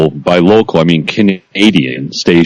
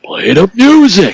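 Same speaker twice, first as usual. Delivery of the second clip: annoyed shout; 13 semitones higher than usual. Retold or imitated. Mock annoyed listener.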